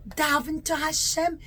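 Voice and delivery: ordinary delivery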